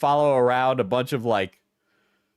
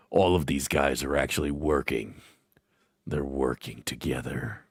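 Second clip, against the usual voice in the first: mock conspiracy theorist; 11 semitones lower, 4 dB quieter than usual. Conspiracy rant parody voice.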